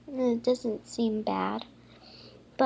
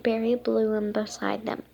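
plain reading voice

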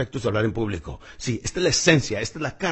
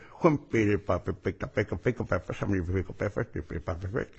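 pen in mouth; 5 semitones lower, 5 dB quieter than usual. Shown as a demonstration. Coach repeats the drill, pen between teeth. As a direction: chew the tongue twister past a pen. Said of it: "Te pones la pluma en la boca y empiezas".

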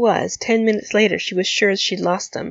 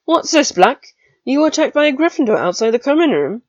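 higher, louder carrying question; 7 semitones higher, 5 dB louder than usual. Acted. Older student Pusey questioning Rigel before the crowd, loud. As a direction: ask it so the room hears. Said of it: "His question was loud".

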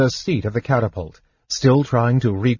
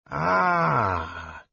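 proud; 2 semitones higher, 6 dB quieter than usual. A narrator's reading. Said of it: "Nosh said proudly".

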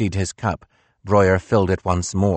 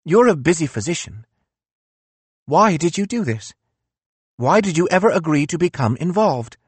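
higher, bitter philosopher voice; reproachful, raised-pitch Nietzsche; 10 semitones higher than usual. Acted.

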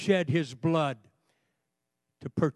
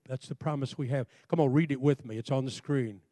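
ordinary delivery

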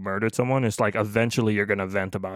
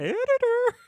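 calling out. Pretend. Calling out to editor.

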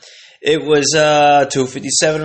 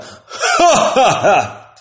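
laughing logger; much slower than usual. Retold or imitated. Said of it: "a guy logging logs would be like".

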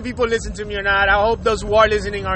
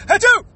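loud high mock sneeze; sneezing on someone on purpose, hammed-up sneeze; fake sneeze; 10 semitones higher, 5 dB louder than usual. Imitated sneeze.

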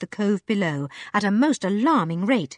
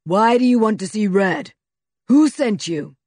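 booming voice; 6 dB louder than usual. A narrator's reading. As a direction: boom out suspiciously. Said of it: "it boomed out again".

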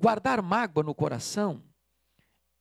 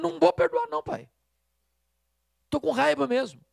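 put-on high, louder child digging in; defiant angry kid; 8 semitones higher, 4 dB louder than usual. Preacher voicing the son refusing again to his father, high and defiant. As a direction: whine it up like a stubborn boy.

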